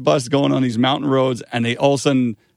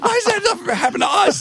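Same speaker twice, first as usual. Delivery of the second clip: much higher, whiny squeal; mock whine. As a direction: complain like a jealous kid. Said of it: "we were like".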